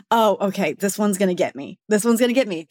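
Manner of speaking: ordinary talk, nothing marked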